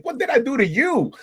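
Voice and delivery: high-pitched mock dismay; retold high, incredulous protest; bewildered protest. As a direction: act out a stunned recruit pleading innocence. Recruit reacting to being screamed at.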